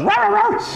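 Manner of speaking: very high yappy mock bark; taunting dog yap. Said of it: "runs in and goes".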